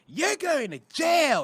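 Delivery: high, slow Pittsburgh voice; mock Pittsburgh girlfriend accusing him; Pittsburgh accent. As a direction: put on her strong Pittsburgh dialect, drawn out. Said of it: "the first thing she said was".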